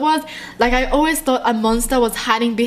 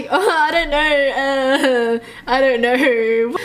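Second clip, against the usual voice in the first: scared; much slower than usual. Real, not acted. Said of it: "I'm just so scared right now".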